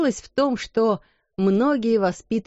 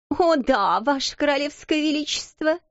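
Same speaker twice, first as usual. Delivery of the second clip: high, sneering mock reverence; bow to her with a sneer; 8 semitones higher than usual. A narrator's reading. Schoolgirl mocking her rival as royalty. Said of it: "насмешливо заметила Лавиния".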